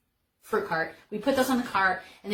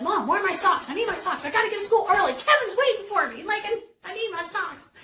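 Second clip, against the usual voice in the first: put-on much higher and louder, laughing through a panicked kid's whine; frantic plea to mom; 12 semitones higher, 5 dB louder than usual. Young [name] urging mom to find her socks, frantic and laughing. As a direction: play your younger self begging for socks, mock panic.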